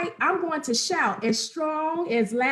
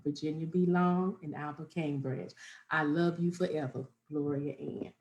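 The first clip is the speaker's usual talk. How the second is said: soft, lower dedication read; lowered, hushed tribute to the grandmas; memorial dedication; 10 semitones lower, 6 dB quieter than usual. Acted.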